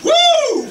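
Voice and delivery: high whooping cheer. Real, not acted. Comic whoops on stage.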